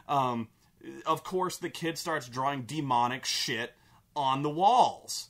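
normal talking voice

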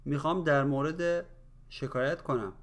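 quick, run together in one breath; natural conversational speed. Shown as a demonstration. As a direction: say it fast like everyday talk. Same phrase at natural speed. (سریع و روان، با سرعت محاوره)

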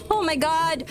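ordinary delivery

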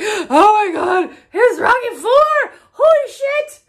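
high, loud squeal of fan excitement; re-enacted ecstatic scream; excited screaming. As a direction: shriek it out, losing it with joy. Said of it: "I screamed in the middle of the store".

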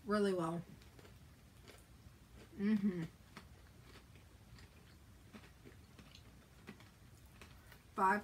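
chewing food while speaking; speaking between bites of snack; talking with mouth full. Real, not acted. Seen 1: Woman speaking is biting and chewing pretzel snack throughout the words.

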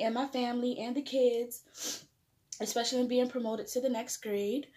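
soft, quiet mealtime prayer. Acted. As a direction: pray quietly over the food. Leads a soft prayer before eating.

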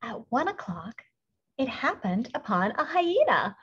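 ordinary delivery, nothing marked

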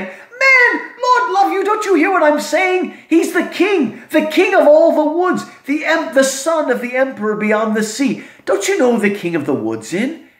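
amazed they don't know, then hushed awe; Mister Beaver. Acted. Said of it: "Mister Beaver says".

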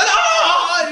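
long, high, loud mock scream; hammed-up wail of pain; agonized scream. Man shot in the leg lies bleeding, mock agonized scream.

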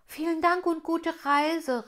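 laut und höher nachgerufen; nachrufen. Acted. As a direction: ruf dem Tropfen hinterher. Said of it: "rief Rubinia ihm nach". (Rubinia ruft dem verschwindenden Tropfen nach, laut)

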